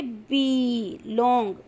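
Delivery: stretched out slowly, बी held long. Shown as a demonstration. Teacher stretching बी long to show the vowel. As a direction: hold बी long and slow. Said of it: "teacher explaining long vowel".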